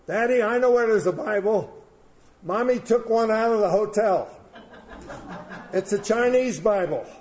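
high, loud imitation of an eager child; twelve-year-old daughter. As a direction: pipe up loudly as a daughter to her daddy. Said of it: "His twelve-year-old daughter says".

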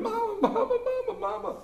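very high mock wail for mother; whining daughter. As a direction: imitate an upset daughter calling out, fading away. Daughter runs off calling mama.

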